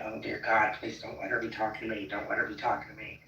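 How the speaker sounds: fast, hushed inner pleading; muttered prayer. Retold or imitated. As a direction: rattle it off under your breath. Retold silent plea to herself.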